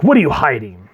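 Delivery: punchy, acted interrogation; mock serious. Joker as Batman confronting a suspect, mock serious. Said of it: "he starts to act serious".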